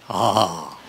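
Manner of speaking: low drawn-out moan; horny moan. Acted. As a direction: groan low with longing. Husband horny groan.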